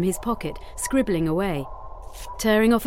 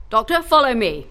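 louder, higher captain's order; brisk command; instructing; 4 semitones higher, 6 dB louder than usual. A narrator's reading. Captain ordering the doctor along, instructing. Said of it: "instructed Fitzgerald".

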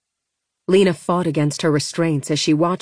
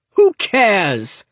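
roaring; 3 semitones higher, 4 dB louder than usual. Acted. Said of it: "roared Corda".

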